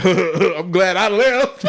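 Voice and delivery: slow, high, drawn-out through laughter; gleeful retold brag, laughing; laughing relief. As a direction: quote the friend while cracking up. Laughing while quoting friend.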